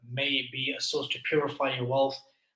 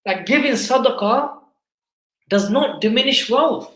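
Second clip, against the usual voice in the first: high, loud sermon delivery; impassioned preaching; 9 semitones higher, 10 dB louder than usual. Real, not acted. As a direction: preach it forcefully, voice raised, phrase by phrase. Preaches on charity, quoting the Quran.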